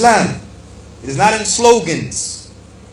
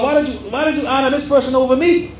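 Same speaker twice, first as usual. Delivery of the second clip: indignant protest, pitched higher; voicing Iblis; 8 semitones higher than usual. Preacher voicing Iblis protesting to Allah, indignant and higher. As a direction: let Iblis sound offended and arrogant, up high. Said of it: "Iblis was saying to Allah".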